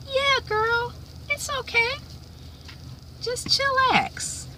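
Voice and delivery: high gentle coaxing to calm the dog; soothing pet talk. Real, not acted. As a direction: coo to calm a shaking puppy. Soothing her trembling dog.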